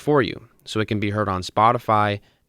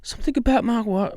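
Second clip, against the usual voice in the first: failed Mark Wahlberg attempt; 12 semitones higher than usual. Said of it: "I can't do it".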